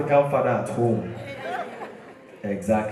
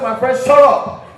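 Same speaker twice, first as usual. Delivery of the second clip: snapping back; 7 semitones higher, 6 dB louder than usual. Acted. Student snapping at teacher.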